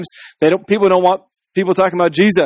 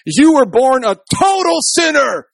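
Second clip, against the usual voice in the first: exclamation shout; 9 semitones higher, slower than usual. Shown as a demonstration. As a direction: hurl it as a condemnation. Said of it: "they do the exclamation point".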